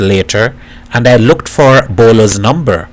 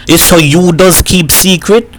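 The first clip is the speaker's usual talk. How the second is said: bitter reproach; 6 semitones higher than usual. A narrator's reading. Repeated, more bitter.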